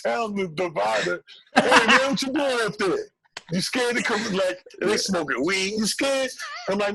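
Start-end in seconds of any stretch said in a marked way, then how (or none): 1.6-6.3 mocking taunt. Comedians taunting through divider.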